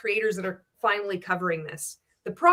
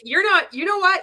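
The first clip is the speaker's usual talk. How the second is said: loud, high-pitched retold comeback; irritated retort; 10 semitones higher, 6 dB louder than usual. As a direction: fire back at an imagined judgmental critic. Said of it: "I just get so irritated".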